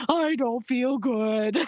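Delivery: slow, high and pitiful; a sick girl in broken French, slow and pitiful; pathetic whimper. Retold or imitated. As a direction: whimper it out slowly. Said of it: "All I could say was".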